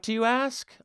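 ordinary delivery

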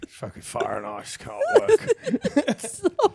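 high, slow laughing Aussie bloke; Australian accent. Retold or imitated. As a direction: drawl it out through laughter. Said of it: "And he's like".

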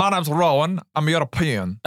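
put-on hammy made-up European accent; continental European accent. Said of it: "This is my European accent".

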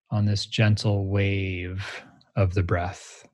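guided meditation. Shown as a demonstration. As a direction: lead a guided meditation, unhurried. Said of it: "let's meditate together right now".

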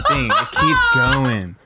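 drawn-out laugh through pain. Real, not acted. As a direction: laugh while stretching it out slowly. Spicy chip burning, laughing.

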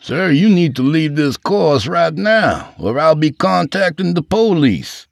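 high-pitched, slowed official order; security guard's warning. Acted. Guard orders drunk man off course, high official voice. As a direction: lay down the law as the guard.

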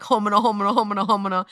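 imitated flustered babble; flustered stammering. Mimicking people caught out by her question, flustered babble. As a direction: run the syllables together in mock speechlessness.

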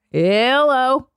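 ordinary delivery

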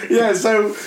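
ordinary talk, nothing marked

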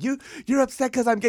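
high, accusing whine; indignant accusation. Retold or imitated. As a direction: throw the accusation back. Younger brother accusing.

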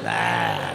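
very low dull grunt; wordless phone-answer noise. Retold or imitated. Man picks up his phone, low dumb grunt. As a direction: answer with a deep, clueless groan. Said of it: "I'm gonna make a noise too".

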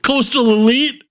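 mocking a sneering anti-intellectual; mocking anti-expert guy. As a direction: sneer at the doctor as a snob. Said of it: "people are like".

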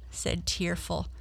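ordinary delivery, nothing marked

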